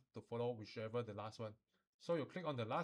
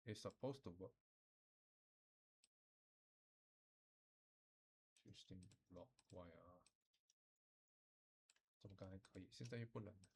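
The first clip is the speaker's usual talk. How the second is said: puzzled under breath; 3 semitones lower, 10 dB quieter than usual. Real, not acted. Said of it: "software fails, speaker puzzled".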